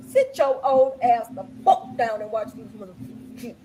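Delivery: ordinary delivery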